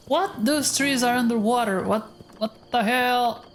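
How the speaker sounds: jolted by something strange; startled. Real, not acted. Sees underwater trees.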